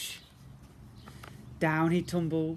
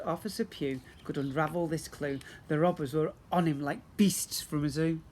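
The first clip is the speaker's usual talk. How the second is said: ordinary delivery, nothing marked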